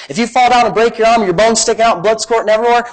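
ordinary talk

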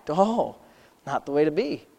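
ordinary talk, nothing marked

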